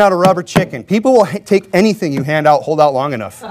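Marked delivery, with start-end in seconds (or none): none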